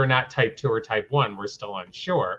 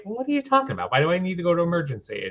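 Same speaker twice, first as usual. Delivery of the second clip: higher, incredulous retold protest; belligerent pushback; 7 semitones higher, much faster than usual. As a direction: fire it back in disbelief. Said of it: "the belligerent athlete of me is like".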